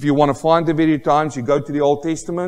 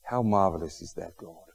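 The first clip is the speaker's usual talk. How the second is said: soft, low prayer voice; 7 semitones lower, 10 dB quieter than usual. Acted. Preacher leading the congregation in prayer, soft. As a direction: pray quietly and reverently.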